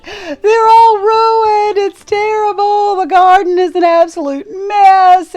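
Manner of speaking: distraught wife crying on the phone to husband. Retold or imitated. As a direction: sob it out, voice breaking high. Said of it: "I called my husband crying and said".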